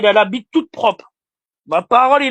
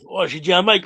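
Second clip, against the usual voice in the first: ordinary delivery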